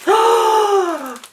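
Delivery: long, high-pitched gasp; astonished gasp. Real, not acted. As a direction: suck in air in real amazement. Sees another gift.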